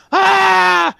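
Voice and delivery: high loud yelp of pain; pained yell. Acted. GM voices the rider hit in the head, yelping. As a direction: yelp as the arrow hits. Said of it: "and he's like".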